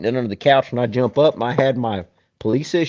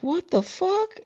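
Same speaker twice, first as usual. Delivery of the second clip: disbelief; 8 dB quieter, slower than usual. Retold or imitated. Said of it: "and I'm like".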